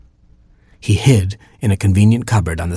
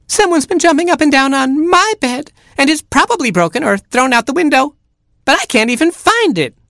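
put-on very high, wailing baby chick; piping, distressed little chick; crying-out chick. A narrator's reading. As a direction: wail it in a tiny squeaky voice. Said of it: "cried Baby Chick".

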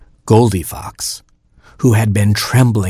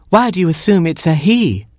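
higher mother hen voice; a fussy mother hen, pitched up; Mama Hen voice; 10 semitones higher than usual. Acted. Mother hen finding her oatmeal eaten, higher voice.

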